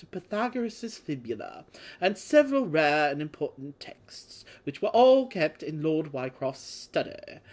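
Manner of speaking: put-on dramatic British news-report accent; a theatrical British newsreader voice; bad British accent. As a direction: read it like a hammed-up London newspaper. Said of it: "I'm just gonna do it".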